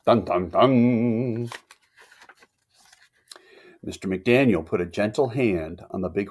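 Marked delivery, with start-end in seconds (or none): 0.1-1.5 slow, sung suspense sting; mock ominous music cue; dramatic dun dun dun. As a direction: hum a slow ominous fanfare. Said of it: "dramatic sting after the name".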